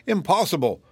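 high-pitched, disbelieving objection; protesting. A narrator's reading. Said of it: "protested the zebra".

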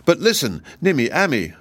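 high, urgent appeal; mild astonishment; astonished. A narrator's reading. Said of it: "said the astonished Woot".

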